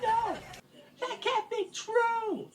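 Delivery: very high, soft wail; distressed denial. Acted. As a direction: protest in a tiny high voice. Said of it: "And he said".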